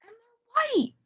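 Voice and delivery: cheerful storybook surprise; delighted reveal. A narrator's reading. Happy reveal.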